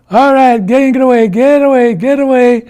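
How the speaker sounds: high, loud, repeated holler; bossy yelling from the hilltop; urgent shout. Acted. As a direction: clear everyone out of the way. Iktomi clears the kids before sliding.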